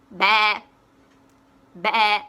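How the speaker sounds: imitated sheep baa. Shown as a demonstration. Teacher to kids, a loud sheep bleat.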